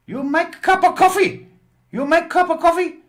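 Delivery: high, loud, broken-English landlady voice; mock Chinese accent. Roommate scolding her young lodger, high loud mock accent. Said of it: "short Chinese woman".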